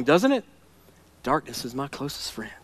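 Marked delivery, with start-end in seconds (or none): 1.2-2.5 much softer and lower, almost to himself; soft murmur. Shown as a demonstration. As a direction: let it sink into a quiet confession. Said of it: "sounds like a country song".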